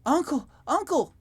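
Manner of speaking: put-on high, loud child calling out; child yelling. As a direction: shout for your uncle excitedly. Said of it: "you yell".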